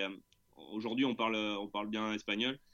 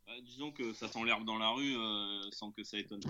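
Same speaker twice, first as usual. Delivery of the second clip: ordinary delivery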